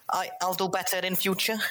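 high, overly polite apology; apologetic [name]. Acted. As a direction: grovel politely. [name]'s character.

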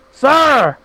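usual speaking voice

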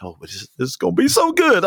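usual speaking voice

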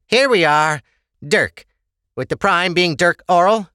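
high-pitched, businesslike clerk; official at a counter. Acted. The hand looks up Ava's record.

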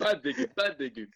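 high mock scolding, South African accent. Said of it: "South African accent, please".

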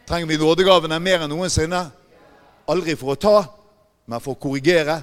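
loud, driving pulpit voice; preaching at full force. Real, not acted. Preacher warning the congregation about spirits, loud and driving. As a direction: hammer it home to the congregation.

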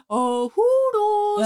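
put-on high, slow little kid voice; toddler voice. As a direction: squeal it like a toddler. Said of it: "he goes".

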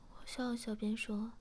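ordinary delivery

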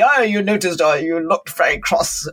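mock accusing, pointing out the crossness; a hint of a blaming, aggressive reproach. Shown as a demonstration. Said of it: "or is it an aggressive tone of voice".

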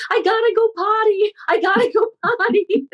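put-on high yelling elderly mother voice. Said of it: "she'd yell out".

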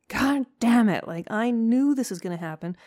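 a lightly voiced, fed-up dog grumbling loud and high; mock dog saying he knew it; exasperated dog voice. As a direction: play the dog cursing his luck. Dog's thoughts voiced aloud.

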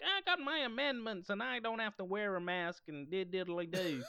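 high-pitched, hammed-up American twang; mock American accent. Host imitating people refusing to wear masks, mock American accent. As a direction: mimic an anti-masker in a silly American accent. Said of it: "I don't know why that's the accent".

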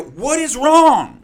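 slow, high, loud exasperation; exasperated shout. Retold or imitated. Frustrated medic yelling at a stubborn patient, slow shout. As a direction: yell it drawn out. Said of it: "And I go".

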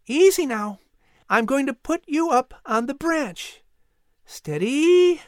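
put-on high, careful boy's voice; steadying boy. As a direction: coax the bird as you lift it, high-pitched. Boy steadies eagle onto branch.

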